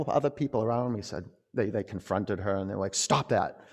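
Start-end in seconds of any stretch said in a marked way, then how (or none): 2.9-3.6 mock sharp command to a dog; retold firm reprimand; scolding a dog. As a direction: order it to stop like a misbehaving dog. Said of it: "like you would tell a dog".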